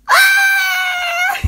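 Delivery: sudden high shriek; scream. Acted. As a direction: let out a loud high scream.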